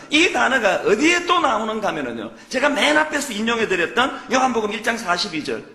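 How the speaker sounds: ordinary talk, nothing marked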